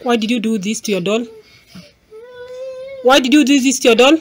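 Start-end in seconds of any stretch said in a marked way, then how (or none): 3.0-4.2 faster, louder and higher scolding repeat; sharper scolding. Real, not acted. Repeats the question more sharply.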